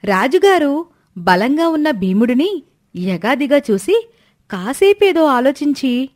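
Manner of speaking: ordinary delivery, nothing marked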